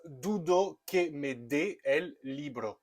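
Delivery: "Dudo que me dé el libro" slow, "dé" stressed; slow Spanish example. Shown as a demonstration. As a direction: spell out the Spanish sentence slowly. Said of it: "dans la phrase".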